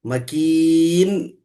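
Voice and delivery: मकीन said slowly, ending on a held nun; stop on the nun. Shown as a demonstration. Teacher to student, showing how to stop on मकीन. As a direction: land on the final नून of मकीन and stop. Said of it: "मकीन बोल के रुक जाना".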